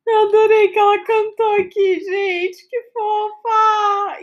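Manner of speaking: squealing with delight. Real, not acted. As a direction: gush over how cute it is. Delighted by the listener singing.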